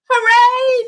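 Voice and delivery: high joyful cheer. Acted. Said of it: "they all shouted".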